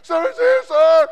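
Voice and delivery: very high, very loud frantic yelp; recruit panicking. Retold or imitated. Recruit sure the bee is on him, very high loud panic. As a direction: squeal it in full panic.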